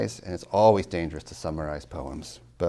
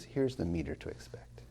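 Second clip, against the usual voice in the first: whispered quote; 8 dB quieter than usual. Retold or imitated. Quoting Fussell, whispered.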